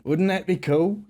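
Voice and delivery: hammed-up Southern accent. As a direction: lay on a thick Louisiana-Texan twang. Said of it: "if I start talking like this".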